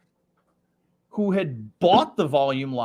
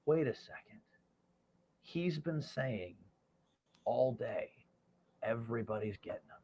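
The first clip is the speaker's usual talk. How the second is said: much softer, hushed realization; inner thought; 2 semitones lower, 8 dB quieter than usual. Retold or imitated. Young salesman thinking to himself, hushed. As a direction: murmur it to yourself.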